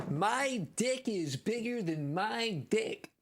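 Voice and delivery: very high, slow, drawn-out mocking quote; mock declaration. Streamer mocking a garbled size comparison, drawn-out ridicule.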